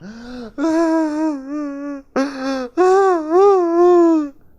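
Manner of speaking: loud, high mock wail of grief; fake wailing gasp. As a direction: wail like a faking mourner. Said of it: "This crying was a fake crying".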